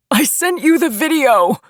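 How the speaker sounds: high, loud frantic shout; desperate, high-pitched yell. A narrator's reading. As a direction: shout it at the top of your voice. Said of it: "Chris shouted at me".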